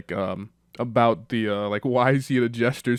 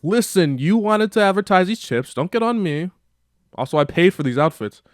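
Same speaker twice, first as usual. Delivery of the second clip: mock irritated snap; 8 semitones higher, 6 dB louder than usual. [name] snaps back at Nina.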